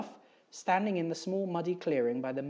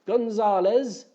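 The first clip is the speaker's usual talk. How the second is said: calling out; 8 semitones higher, 10 dB louder, slower than usual. Acted. Crewman calling over helicopter noise.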